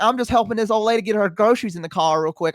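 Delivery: put-on high-pitched Southern little kid; eager child with a strong Southern accent; childhood Southern accent. Reassuring mom while helping old lady, high Southern kid voice. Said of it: "I had such a strong Southern accent".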